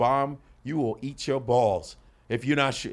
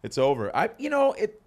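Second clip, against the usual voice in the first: ordinary delivery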